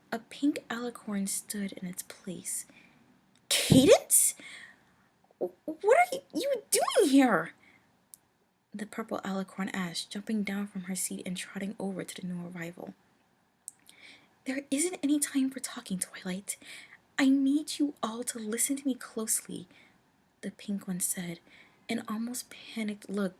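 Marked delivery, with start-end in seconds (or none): 3.5-7.5 surprised. Acted. Surprised Twilight sees Cadance. 14.4-19.7 urgent. Acted. Cadance urgent warning, panicked.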